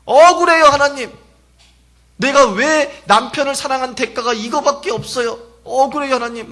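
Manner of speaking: high, loud anguished cry to God; wailing lament. Acted. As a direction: cry out your grievance like pounding the chest. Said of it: "토해내면서 통곡하고 땅을 쳤다는 거예요".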